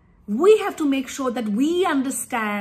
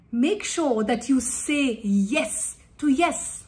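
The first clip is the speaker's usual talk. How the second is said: usual speaking voice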